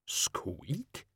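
tiny startled squeak, hushed; a soft shocked rat squeak. Acted. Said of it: "in sheer shock".

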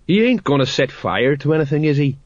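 mildly worried sergeant; anxious. A narrator's reading. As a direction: ask with a touch of anxiety. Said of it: "said the sergeant anxiously".

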